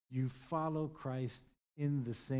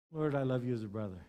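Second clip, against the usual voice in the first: ordinary delivery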